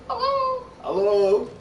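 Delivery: very high, piping hello; thrown character voice. Shown as a demonstration. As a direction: call hello as if from behind someone. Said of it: "to make your voice go from forward to backwards".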